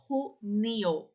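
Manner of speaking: "junho" said as Spanish junio with a throaty h. Shown as a demonstration. Teacher models the throat j for students. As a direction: say "junho" slowly with a rasp from the throat. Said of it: "letrinha J que fica aqui na garganta".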